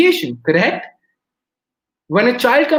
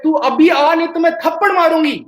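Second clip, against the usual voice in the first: higher, louder mock scolding from a mother; imitated angry shout; 8 semitones higher, 4 dB louder, faster than usual. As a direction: act out a mother shouting a threat at her child. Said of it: "She starts shouting".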